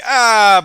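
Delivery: very high, loud terrified shriek; enthusiastic scream. Acted. Girl fleeing a horde of zombies, shrieking very high and loud. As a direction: scream your lungs out in fright. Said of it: "but with a lot of enthusiasm".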